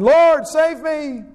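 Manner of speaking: high, slow desperate plea; crying out. A narrator's reading. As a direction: cry out like a drowning man. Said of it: "he cried out".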